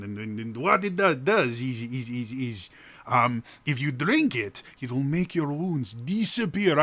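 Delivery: loud, high Baltic Russian shopkeeper; good-natured shopkeeper in cheesy Russian accent. Acted. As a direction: hawk wares with hammed-up Russian enthusiasm. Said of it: "like, Baltic Russian and an accent".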